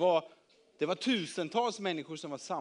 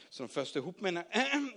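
ordinary delivery

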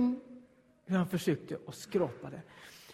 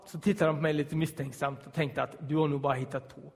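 usual speaking voice

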